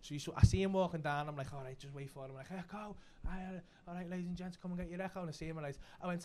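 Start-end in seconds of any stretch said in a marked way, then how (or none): 1.5-2.3 very soft note to self; hushed self-talk. Retold or imitated. Waiting for Tim to walk down, hushed self-talk. Retold thought while waiting. 2.5-5.2 soft, half-hearted street cry; understated vendor patter. Retold or imitated. Echo seller as Tim approaches, softly played-down patter.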